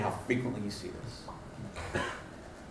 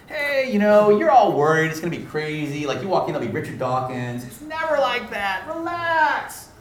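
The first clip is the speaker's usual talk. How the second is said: breezy reassurance; 7 semitones higher, 5 dB louder than usual. Acted. Trainer telling nervous students to relax, breezy and exaggerated. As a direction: play an overconfident coach waving worries away.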